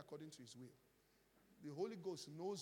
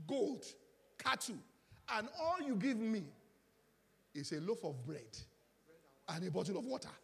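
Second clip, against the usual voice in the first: much louder and higher, each item hammered; indignant preaching shout; 5 semitones higher, 9 dB louder than usual. Acted. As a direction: make the crowd feel the injustice. Preacher voices indignation at meagre gift.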